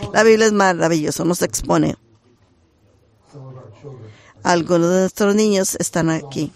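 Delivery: normal talking voice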